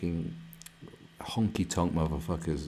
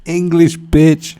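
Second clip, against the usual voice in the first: loud high shout of English, bitch; a loud, higher, playful mock yell; 8 semitones higher, 18 dB louder, much slower than usual. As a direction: yell it at the screen. Shouting at foreign streamer.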